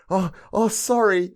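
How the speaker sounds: high, loud, breezy mock apology; fake careless sorry. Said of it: "she fakes this mad careless one".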